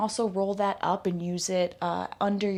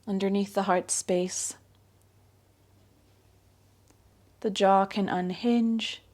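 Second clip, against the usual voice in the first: guided meditation; 4 dB louder than usual. Acted. Yoga teacher guiding her class into yogic sleep, calm guided meditation. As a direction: ease the listener down into rest, unhurried.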